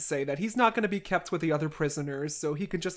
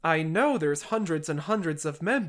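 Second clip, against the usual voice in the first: ordinary delivery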